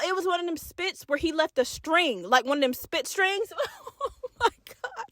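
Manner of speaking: ordinary talk, nothing marked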